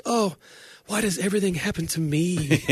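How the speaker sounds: high, whiny Rachel from Friends imitation. Friend retelling a Friends scene, imitating a whiny Rachel. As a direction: moan it like Rachel, self-pitying. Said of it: "She goes".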